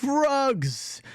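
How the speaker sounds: high-pitched hawker's call; rug seller's cry. Acted. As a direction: call your wares out high across a market. Rug sellers calling out to passersby.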